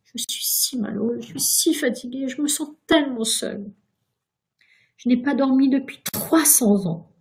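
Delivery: high, self-pitying whine; plaintive lament. A narrator's reading. Ghost confiding his loneliness to Virginia, plaintive lament. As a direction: pour out your misery plaintively. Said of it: "pleura le fantôme".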